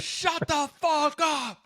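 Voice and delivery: high, slow, shouted disbelief; mock outraged shout. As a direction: yell it like the office firebrand. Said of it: "she goes".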